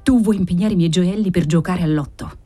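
plain reading voice

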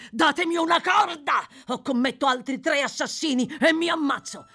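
high, loud frantic threat; desperate shout. Acted. Sykes demands a rope from his companions, frantic shout. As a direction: demand it at the end of your rope.

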